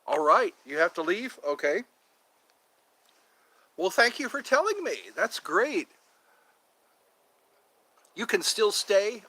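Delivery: ordinary delivery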